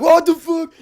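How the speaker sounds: high, strained imitation of a shocked crowd; hammed-up high-pitched disbelief; mock shocked outcry. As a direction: cry out like alarmed onlookers. Crowd reacts to the hit.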